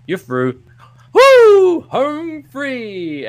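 loud, very high whoop; celebrating trucker. Acted. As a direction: burst out as if home free. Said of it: "And the first guy goes".

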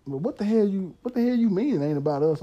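serious demand. Retold or imitated. Woman challenging him on why men cheat, serious and indignant. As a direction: push back hard, as she did. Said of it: "'cause she was serious".

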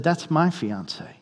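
soft and slow, barely above a breath; stunned hush. Retold or imitated. Stunned soldier recognizing his fiancée's picture, soft and slow. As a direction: let it land in quiet disbelief.

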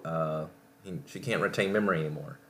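ordinary talk, nothing marked

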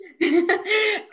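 a loud, high, bright burst of laughter; real burst of laughter. Real, not acted. Interviewer jokes, she laughs.

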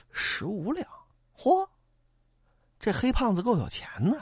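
hushed greedy mutter to himself; a quiet, scheming aside. Acted. Boatman sizing up the rich passenger, muttering greedily.

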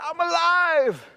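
high, slow, weak squeak; feeble squeak. Acted. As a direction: squeak it out like a broken man. Broken man squeaks he lives.

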